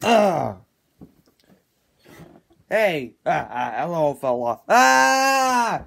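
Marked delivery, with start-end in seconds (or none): none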